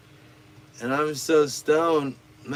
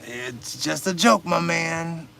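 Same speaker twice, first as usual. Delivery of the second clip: ordinary talk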